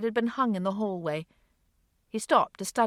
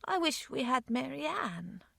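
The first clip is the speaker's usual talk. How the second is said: put-on soft, slow little girl's voice with a quiver; softer, wistful child; quivering wish; 6 semitones higher, 5 dB quieter, slower than usual. A narrator's reading. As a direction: hold back tears as you admit it. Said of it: "a slight quiver in her voice".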